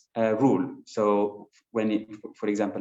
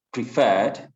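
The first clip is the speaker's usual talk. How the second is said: "preferred" with the second syllable louder and drawn out; stress on last syllable; 5 dB louder than usual. Shown as a demonstration. Teacher giving an example of last-syllable stress, stressed "preferred". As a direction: lean hard on the end of "preferred". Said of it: "the last syllable".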